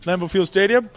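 high, gushing real estate pitch; realtor voice. Pretend. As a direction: sell the house like a game show host. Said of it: "Is that a realtor voice?".

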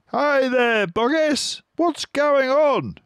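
very high, loud jeering call; villager calling. A narrator's reading. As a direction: shout down into the crater.

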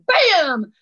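one loud, high shot noise; imitated gunshot. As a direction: fire the rifle with your voice.

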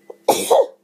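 sudden loud sneeze; real sneeze. Real, not acted. Vlogger interrupted mid-story by a sneeze, loud and sudden. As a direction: let out a sharp, sudden sneeze. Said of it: "excuse the sneeze".

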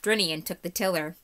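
ordinary delivery

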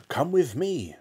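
high, urgent call; eager yell. Acted. Mister Cranky grabbing George, high and urgent. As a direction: hurry him along. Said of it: "Mister Cranky yelled".

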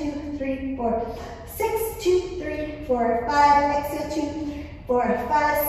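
high, punchy coach count; counting workout reps. Shown as a demonstration. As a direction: call each count out strongly. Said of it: "Now let's do the full version".

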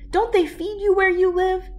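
high, loud jeering question; mock him like a schoolyard bully; story reader's high-pitched sneering boy. A narrator's reading. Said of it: "he sneered".

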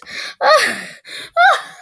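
shrieking laugh, way up high; high burst of laughter. Real, not acted. Laughing at an ad that misspells horse, shrieking high. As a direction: crack up at the silly spelling.

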